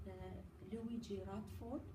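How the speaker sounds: normal talking voice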